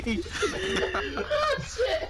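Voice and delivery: high breathless shriek of laughter. Real, not acted. Laughing after a scare.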